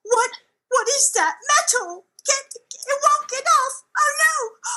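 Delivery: comic terror climbing in pitch; an employee freaking out at finding metal under their skin; hammed-up panic.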